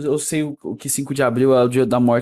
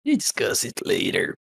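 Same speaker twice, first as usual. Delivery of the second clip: whispered; 4 semitones lower, 5 dB quieter, slower than usual. Real, not acted. Aside in English, whispered.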